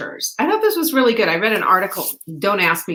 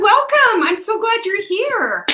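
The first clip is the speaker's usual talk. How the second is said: bright show opening; 12 semitones higher, 3 dB louder than usual. Acted. Opens live show greeting viewers.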